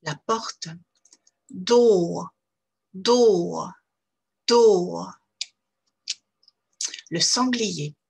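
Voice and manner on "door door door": "Ball" with a long rounded O, loud. Shown as a demonstration. Teacher drilling the long O, loud. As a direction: stretch the O in "Ball". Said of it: "le mot door".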